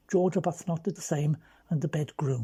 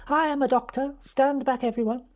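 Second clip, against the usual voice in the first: mock commanding doctor; 7 semitones higher than usual. Frankie imitating George ordering bystanders back, mock commanding. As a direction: play the brisk doctor clearing the crowd, hammed up.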